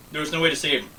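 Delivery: ordinary delivery, nothing marked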